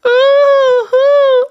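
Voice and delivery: loud, very high "ooh"; mock the wooing as a crying wail; sustained piercing hoot; high imitated wail. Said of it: "You go".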